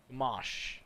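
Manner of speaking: "Gamash" ending on sh, falling; silent E. Shown as a demonstration. Announcer retrying the name, "Gamash" without the E. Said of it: "You don't pronounce the E".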